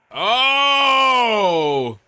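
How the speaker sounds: sudden loud high cry of alarm; alarmed shout. Real, not acted. Watching a gymnast almost fall, a loud alarmed cry. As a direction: yelp out as the gymnast nearly falls. Gymnast nearly falls on screen.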